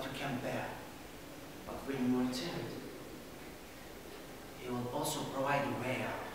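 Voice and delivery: soft, low, measured recitation; solemn scripture reading. Acted. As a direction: read the verse gently like a sermon.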